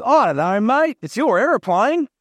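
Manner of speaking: high, swooping Aussie joke voice; Australian accent. Acted. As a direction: play up a broad Australian accent for the punchline. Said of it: "I'm doing Australian accents as well today".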